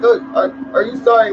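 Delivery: put-on high, cooing question to a child; doting parent. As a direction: imitate a melting, pushover parent. Coos at apologizing kids.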